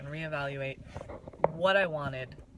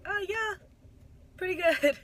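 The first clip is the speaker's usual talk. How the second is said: much higher, louder, coy understatement; teasing friend by downplaying a huge number; mock understatement; 8 dB louder than usual. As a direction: play it cool while bursting with pride. Her dry reply about the numbers.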